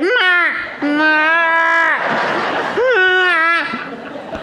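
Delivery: high, drawn-out mock bawling; imitated child's meltdown cries; unintelligible tantrum wails. Son thrown down on the ground, imitated high tantrum wails. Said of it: "just saying unintelligible things".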